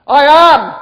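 very high, very loud proclamation; booming declaration. Acted. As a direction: shout it out as a divine declaration. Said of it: "what he just declared was this".

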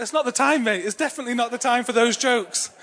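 mock outraged retort at a bad joke; exasperated. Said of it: "I was like".

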